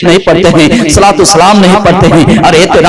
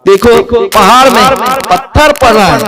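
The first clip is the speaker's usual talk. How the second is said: raised, sing-song preaching of the story; 7 semitones higher than usual. Acted. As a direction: preach the tale to the gathering.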